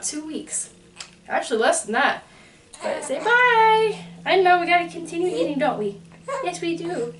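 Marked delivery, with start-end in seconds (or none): 2.8-4.1 put-on baby talk. Mom voicing baby goodbye. 4.2-7.1 high sing-song cooing. Real, not acted. Mom cooing to baby.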